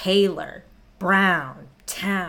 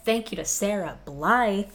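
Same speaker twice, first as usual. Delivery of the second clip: normal talking voice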